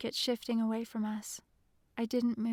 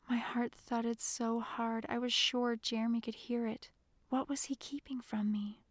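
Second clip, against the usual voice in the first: ordinary delivery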